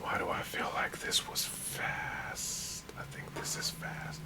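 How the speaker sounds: whispered aside to himself; hushed whisper to no one. Real, not acted. Muttering to himself over beat.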